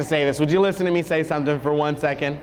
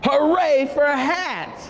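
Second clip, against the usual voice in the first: high, slow joyful cheer; 8 semitones higher than usual. Acted. Elephant delighted by his present, cheering high. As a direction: celebrate the new hat. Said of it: "hard to stay grumpy".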